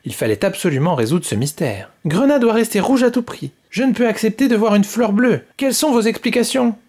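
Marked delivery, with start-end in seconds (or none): 2.0-6.8 haughty little queen, pitched up; demanding queen. Acted. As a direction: demand answers from the counsellors. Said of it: "demanda Rouge".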